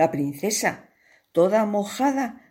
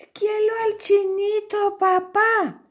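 put-on much higher, girlish pleading; pleading princess. Princess begs her father, the king, high and pleading. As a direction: beg your father sweetly.

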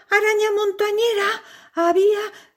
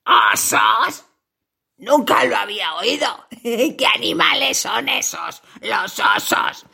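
low, menacing spider voice; a big hungry spider toying with its prey; 10 semitones lower than usual. Acted. Spider puzzled by bears.

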